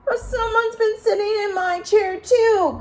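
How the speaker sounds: very high, loud little cub; a small bear wailing over his chair; Baby Bear crying out. A narrator's reading. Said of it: "cried Baby Bear".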